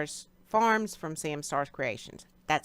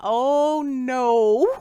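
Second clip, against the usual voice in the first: dismayed; 5 semitones higher, 9 dB louder than usual. Retold or imitated. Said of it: "I thought".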